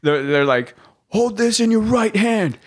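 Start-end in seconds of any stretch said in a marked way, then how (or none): 1.1-2.6 high-pitched mimicked command; imitated instructor order. Instructors ordering lost recruits around, imitated command.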